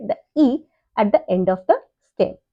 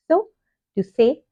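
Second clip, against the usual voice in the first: usual speaking voice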